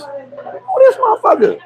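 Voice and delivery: high, slow mocking voice; high slow put-on voice sizing up a stranger; mock tough cousin. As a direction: squeak it out like a suspicious cousin. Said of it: "the first thing they gonna say is".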